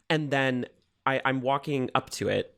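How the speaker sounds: ordinary delivery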